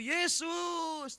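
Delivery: high, drawn-out call; acted shout for help; crying out. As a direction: call out to someone passing by. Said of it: "Lalu ia berseru".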